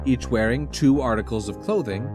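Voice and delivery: ordinary delivery, nothing marked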